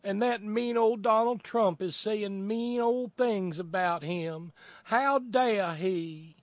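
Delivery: high, loud Southern indignation, hammed up; put-on Southern pearl-clutching; mock Southern accent. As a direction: wail in Southern mock outrage, dragging the ending. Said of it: "doing this in a Southern accent".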